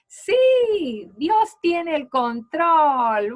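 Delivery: high, loud and slow, bursting with joy; happy girl exclaiming. Retold or imitated. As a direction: cry it out like a delighted little girl. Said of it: "Está expresando que está contenta". (niña feliz exclamando, aguda, fuerte y lenta)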